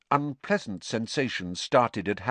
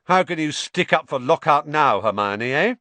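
calling through curtain, louder; 5 semitones higher, 6 dB louder than usual. Acted. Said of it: "Ron called through the curtain".

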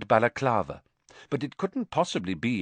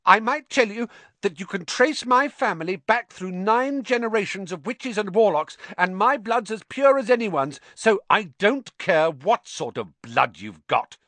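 fierce; 11 semitones higher, 4 dB louder than usual. A narrator's reading. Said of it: "said Harry fiercely".

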